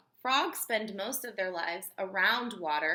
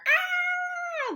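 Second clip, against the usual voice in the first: a short piercing mock scream, much higher; 6 dB louder than usual. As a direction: let out the screamer frog's shriek. Acting out the screamer frog.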